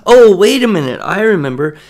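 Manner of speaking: put-on a child who just figured it out; sudden realization.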